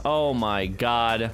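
ordinary talk, nothing marked

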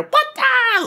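high-pitched startled outburst; mock panicked yell. Storyteller imagining a jumping spider, high panicked yell. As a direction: shriek it as if it just leapt at you.

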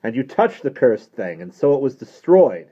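loud, emphatic pronouncement; forceful, weighty explanation; solemn priest. A narrator's reading. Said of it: "said Father Higgins solemnly".

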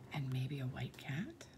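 soft secretive whisper; hushed storytime question. Acted. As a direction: whisper the hint to the kids. Whispered aside to children.

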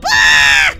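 loud burst of a scream; sudden loud shriek. Acted. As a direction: scream as if grabbed. Attacked by the doll.

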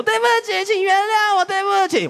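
imagined girlfriend pleading, mock tearful; mock crying apology. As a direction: wail the apology in jest. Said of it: "追起来说".

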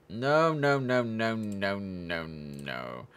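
urgent, loud no's that fade out as he catches a mistake; oops, real alarm that trails off. Real, not acted. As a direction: stop yourself mid-mistake, loud then fading. Catching his own wrong clearance.

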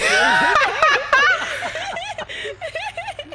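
high burst of laughter. Real, not acted. Caught on a call, she bursts out laughing. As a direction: laugh out hard at the joke.

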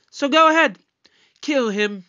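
high, loud goading; defiant dare. Acted. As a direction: dare them to do it, pitch jumping up. Daring villain to kill hostage.

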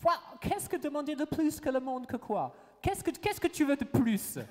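high, loud, theatrical demand; hammed-up exclaiming.

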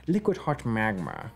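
drawn-out villain impression; hammy evil-genius voice; Doctor Evil. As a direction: stretch out the key words like a cartoon mastermind. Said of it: "Doctor Evil".

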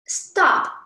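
"Stop" starting high and dropping quickly; sharp "Stop" from top to bottom; falling tone. Shown as a demonstration. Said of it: "top one to the bottom".

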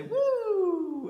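high, loud whoop as the scarf flies; gleeful whoop; excited woo. Shown as a demonstration. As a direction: cheer it up high like a tossed scarf. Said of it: "and we'll say".

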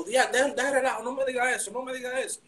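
high stunned disbelief; can't believe the good news. Retold or imitated. Disbelief at being signed.

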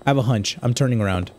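ordinary talk, nothing marked

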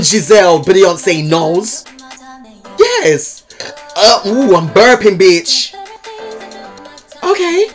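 ordinary delivery

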